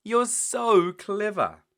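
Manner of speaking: high, loud, slow praise; excited child. Acted. Peppa praising Mummy, high and excited. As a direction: gush over Mummy.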